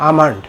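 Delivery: আমন্ড as "AA-mand", silent l; correct pronunciation. Shown as a demonstration. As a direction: open "aa", straight into m in আমন্ড. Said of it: "সঠিক উচ্চারণ হবে".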